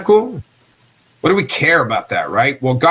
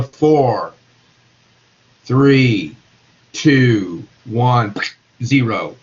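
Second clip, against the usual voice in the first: low, spaced-out numbers; each number set apart and pitched lower; slowing countdown; 4 semitones lower, much slower than usual. Shown as a demonstration. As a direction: count down slowly, a pause between numbers. As soon as those molecules are moving slower.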